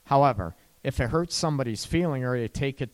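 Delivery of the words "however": normal talking voice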